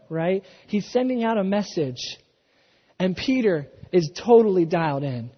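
normal talking voice

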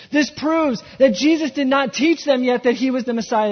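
preacher building passion before the congregation; full-force preaching. Acted. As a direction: preach it with fire.

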